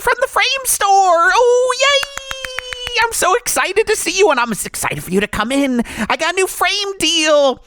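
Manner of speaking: high, bright-eyed salesman voice turning menacing; chirpy pitchman who threatens murder; a cheery killer doll hawking frames; Chucky voice. Acted. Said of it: "let me just do this as Chucky".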